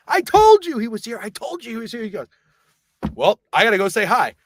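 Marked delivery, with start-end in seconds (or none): 0.1-2.0 high, strained retort; reenacted high-pitched protest; exasperated I-told-you-so. Frantic retort to Greg. 3.1-4.3 raised, louder imitation of a drunk friend; pushy, hammed-up eagerness; mock eagerness. Greg's eager announcement.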